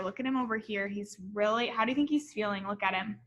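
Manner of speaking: plain reading voice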